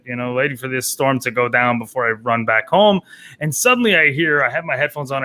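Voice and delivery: ordinary talk, nothing marked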